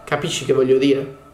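plain reading voice

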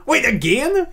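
ordinary delivery

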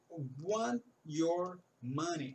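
very slow "want your money"; very slow demo. Shown as a demonstration. Teacher slowly models the phrase for students. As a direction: stretch it out for learners. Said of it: "como ele fala".

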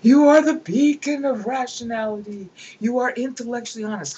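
loud, high courtly flattery; fawning flatterer. Retold or imitated. Rhetorician flattering an emperor on his accession, loud and gushing. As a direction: gush praise like a paid orator. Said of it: "rhetorician would make to an emperor".

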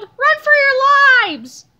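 slow, high, full shout; alarmed cry. A narrator's reading. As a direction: stretch out a loud panicked warning. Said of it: "cried Harold".

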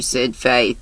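ordinary delivery

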